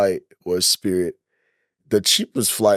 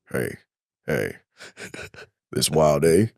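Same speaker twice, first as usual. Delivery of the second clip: lower, quieter nudging stranger; lower seatmate voice; 8 semitones lower, 4 dB quieter than usual. Retold or imitated. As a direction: lean over and mutter to the stranger. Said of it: "dude's like".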